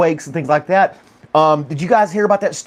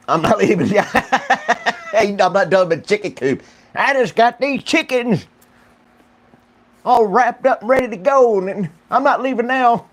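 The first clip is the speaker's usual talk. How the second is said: lightly voiced higher old farmer, laughing; stubborn chicken farmer; 7 semitones higher than usual. Mocking a farmer who won't leave his chicken coop, higher voice.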